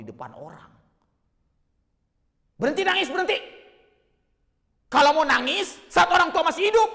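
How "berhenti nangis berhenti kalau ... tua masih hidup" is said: loud, high, furious outburst; angry scolding. Acted. Scolding older sibling who came fainting at father's death, angry shout. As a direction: shout them down to stop the crying. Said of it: "habis saya omeli di".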